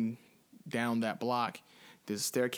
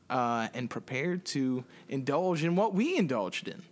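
usual speaking voice

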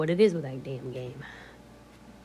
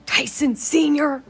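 calling out; 9 semitones higher, 9 dB louder, slower than usual. Real, not acted. Calling out to someone nearby.

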